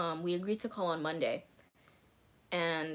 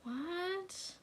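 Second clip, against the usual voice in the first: high squeaked disbelieving what, much higher; stunned what; 13 semitones higher, 5 dB quieter than usual. Retold or imitated. Reacting to friend's excuse.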